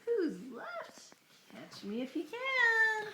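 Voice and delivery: high, slow, taunting cookie voice; sing-song taunt, drawn out slow; gingerbread baby voice. Acted. Character taunt in story.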